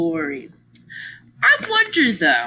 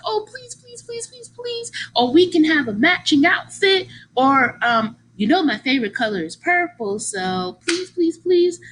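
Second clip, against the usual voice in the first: put-on higher, pleading little-kid voice; childlike begging; 7 semitones higher than usual. Retelling how she begged her grandma as a kid, pleading. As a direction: beg grandma like a young girl.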